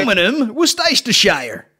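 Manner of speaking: high, loud mock American; mock American accent. Mocking Americans saying the sauce, hammed-up American accent. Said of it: "Fucking Americans".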